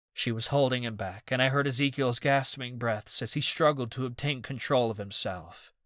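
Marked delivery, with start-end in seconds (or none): none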